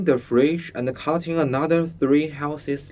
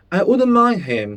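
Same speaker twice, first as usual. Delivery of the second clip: put-on higher, louder boyish bravado; trying to sound brave; 9 semitones higher, 8 dB louder than usual. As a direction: puff up to sound braver than you feel. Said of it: "trying to sound braver than he really was".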